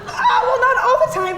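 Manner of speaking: high, loud laughing admission. Real, not acted. Admitting the fart habit to her date, laughing. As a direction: giggle through the confession.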